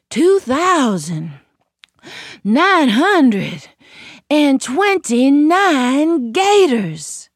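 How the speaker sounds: slow, loud, high call across the swamp; calling back. A narrator's reading. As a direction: call the number back over the water. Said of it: "Rabbit called back".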